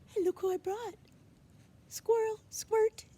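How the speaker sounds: put-on high, eager boy greeting a friend; excited high kid voice. A narrator's reading. Charles happily greets the squirrel, high and excited. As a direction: pitch way up like a thrilled child.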